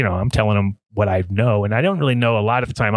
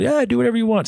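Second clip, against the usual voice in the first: higher, casual permission; boss's permission; 12 semitones higher than usual. Retold or imitated. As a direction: toss it off like a carefree boss. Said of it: "I was like".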